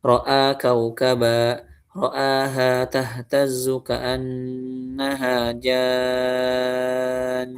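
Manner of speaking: ro'a kaukaba recited slowly and plainly; slow recitation. Shown as a demonstration. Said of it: "Apa contohnya".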